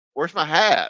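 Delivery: retold question. A rider turns to the streamer about his hat, retold loud question. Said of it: "and he goes".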